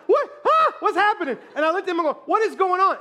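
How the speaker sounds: high, loud, bewildered questions; acted panic. Novice flyer asks pilot about alarms, panicked.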